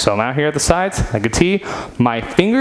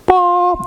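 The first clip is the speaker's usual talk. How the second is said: playful boop; 3 dB louder than usual. Real, not acted. Playful sound as lights turn on.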